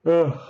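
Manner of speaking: a real yawn rising high; sleepy yawning oh. Real, not acted. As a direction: open yawn drawn out on the oh. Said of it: "sorry, I'm yawning".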